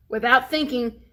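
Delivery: usual speaking voice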